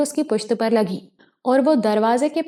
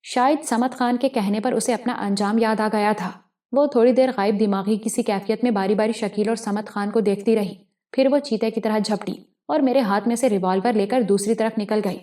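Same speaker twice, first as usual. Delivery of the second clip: usual speaking voice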